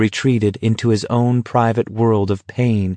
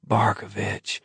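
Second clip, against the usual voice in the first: hoarse and much softer; 8 dB quieter than usual. Acted. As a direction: rasp the name out, drained. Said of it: "Baker said hoarsely".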